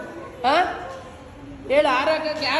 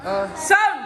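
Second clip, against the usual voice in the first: higher-pitched call for sound; 5 semitones higher, slower than usual. Real, not acted. Host to sound operator, cueing music, calling out. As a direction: shout it across to the sound operator.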